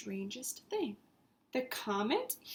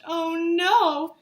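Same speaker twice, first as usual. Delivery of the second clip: louder alarmed cry; alarmed yell; 5 semitones higher, 8 dB louder than usual. Acted. Marty seeing Hubble stuck, loud and alarmed. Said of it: "he yelled".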